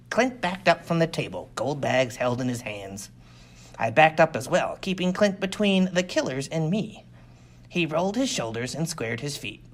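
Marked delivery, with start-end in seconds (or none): none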